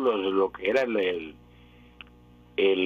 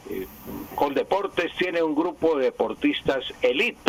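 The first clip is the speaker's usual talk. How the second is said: ordinary delivery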